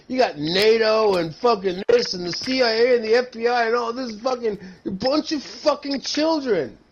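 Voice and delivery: normal talking voice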